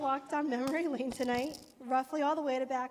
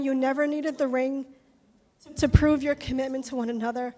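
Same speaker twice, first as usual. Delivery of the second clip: ordinary delivery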